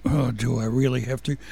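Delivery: low, tired and quiet; weary groan. Retold or imitated. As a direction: drag it out like waking up. Weary morning thought quoted.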